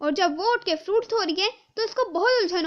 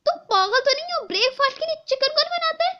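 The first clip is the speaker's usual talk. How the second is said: incredulous scolding; 9 semitones higher than usual. Acted. Stepmother mocks Naina for making chicken at breakfast, high and scornful. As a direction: ridicule the girl's breakfast choice.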